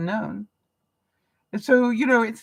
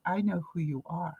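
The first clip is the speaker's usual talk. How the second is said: softer, lower imitation of a knowing stranger; hushed knowing voice; 4 semitones lower, 9 dB quieter than usual. Coffee server to the customer busy with emails, hushed knowing voice. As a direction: say it low and quiet, as a discreet recognition.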